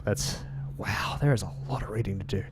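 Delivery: real whispered aside about the long read. Real, not acted. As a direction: mutter it to yourself under your breath. Aside to viewers after reading.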